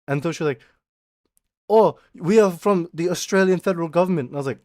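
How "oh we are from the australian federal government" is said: put-on raised scam caller in an Indian accent. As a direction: mimic an Indian-accented phone scammer, pitched up. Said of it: "have a goddamn Indian accent".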